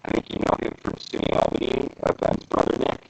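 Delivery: robotic voice filter; glitchy robot voice. Real, not acted. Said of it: "you're going in and out".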